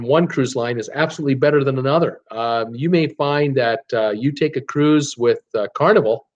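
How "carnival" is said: ordinary talk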